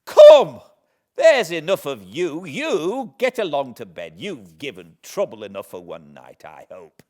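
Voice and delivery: sudden loud shout into a scold; bossy sister barking orders; sharp scolding. Acted. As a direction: swoop on the boy and send him to bed. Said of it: "would swoop upon me with".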